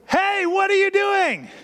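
high, slow call of a stern parent; parent catching kid. Retold or imitated. Parent calling to a child drawing on the wall, high and stern. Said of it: "And you say".

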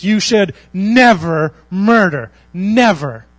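"never" drawn out, slow and weighty; stress on never. Shown as a demonstration. Pastor explaining the commandment's never, slow heavy stress. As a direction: lean slowly on the word "never". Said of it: "is the never".

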